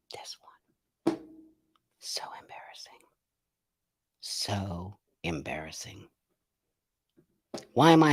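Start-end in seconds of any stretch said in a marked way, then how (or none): 0.1-3.0 fast breathless whisper, almost no voice. Real, not acted. Streamer whispering to himself about the embarrassment. Muttered aside after reading comment. 4.2-6.1 much softer and slowed, drawn out. Real, not acted. Streamer quietly lamenting the embarrassing moment. Repeats it slowly and softly.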